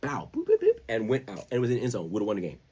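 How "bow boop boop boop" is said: high, loud bouncing-ball sound effects; imitated bouncing ball. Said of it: "the ball was like".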